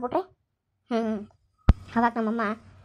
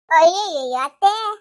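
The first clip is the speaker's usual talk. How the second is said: loud, slow, drawn-out scoff; mocking exclamation; 2 semitones higher, 7 dB louder, slower than usual. Daughter-in-law mocks the mother-in-law, loud drawn-out scoff. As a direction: stretch it out mockingly.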